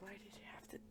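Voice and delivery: very soft, voiceless whisper; whispered complaint. Retold or imitated. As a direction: barely breathe the question. Voicing her complaint to Carter.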